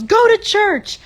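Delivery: high, loud sneering jeer; mock shout from a passing car; mocking the driver. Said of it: "drives by and goes".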